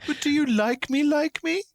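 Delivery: desperate needy plea. Pretend. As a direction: squeak it out like a needy teen begging for a yes. Mocking Elo's desperate fishing.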